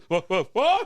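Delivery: loud laughing warning call; yelling four. Retold or imitated. Justin warning golfers ahead, loud laughing yell. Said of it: "He goes".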